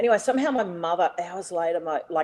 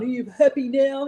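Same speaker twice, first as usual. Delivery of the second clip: raised, louder mock confrontation; higher-pitched acted-out challenge; retold angry challenge; 8 semitones higher, 6 dB louder than usual. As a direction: throw it out as a retold taunt. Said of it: "You know, like this".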